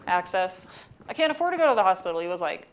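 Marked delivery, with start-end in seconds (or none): 1.1-2.2 rapid, raised-pitch mock plea; retold insistent protest. Student refusing the hospital in a retelling, rushed insistent protest. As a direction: hurry through it higher, imitating an insistent student.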